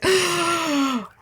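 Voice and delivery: long, high theatrical gasp; mock shocked gasp. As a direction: gasp like a whole scandalized room. Everybody's mock shock retold.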